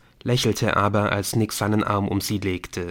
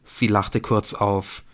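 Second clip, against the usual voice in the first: ordinary delivery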